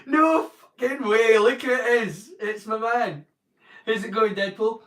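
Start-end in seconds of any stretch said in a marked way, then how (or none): 0.1-2.3 loud, high delighted hey; excited greeting. Real, not acted. To Deadpool joining the stream, a loud delighted greeting. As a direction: greet a mate who just showed up.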